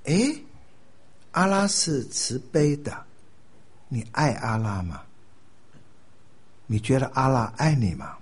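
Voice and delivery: slow, leading questions; gentle probing question. Acted. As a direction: pose it slowly and carefully. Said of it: "那你问他".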